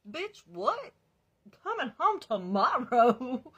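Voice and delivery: high, loud disbelieving what; incredulous. Retold or imitated. Learning boyfriend is coming home tomorrow, loud incredulous disbelief. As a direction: stretch out the outrage slowly.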